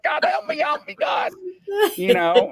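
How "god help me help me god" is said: very high, shrieking mock panic; terrified pleading. Panhandler begging the officer not to kill him, shrieking. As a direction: squeal it like you think you're about to die. Panhandler screaming after crash.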